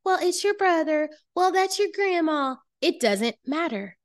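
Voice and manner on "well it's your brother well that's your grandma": higher, lightly mocking voice of people pushing back; mock objecting relatives. Mocking the people who say family comes first, higher voice. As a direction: toss off their excuses in a higher, whiny register. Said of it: "people that are like".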